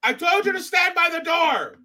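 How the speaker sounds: put-on a father barking at his kid; retold father's shout. Father yelling at his daughter from the stall, high scolding shout.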